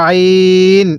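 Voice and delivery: আইন squeezed lightly in the throat, long held ee; drawn-out throaty আইন; light throat pressure. Shown as a demonstration. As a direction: stretch আইন from the throat on a level note. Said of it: "এরকমভাবে শিখব".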